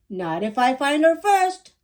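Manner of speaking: put-on boy determined to beat his sister; competitive. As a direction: race her for it. Zach racing his sister.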